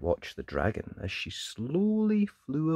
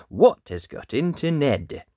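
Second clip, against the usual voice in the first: plain reading voice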